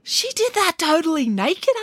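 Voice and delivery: high-pitched incredulous question; shocked child. Acted. Michael interrupts nanny's tale, shocked. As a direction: ask it high, in disbelief.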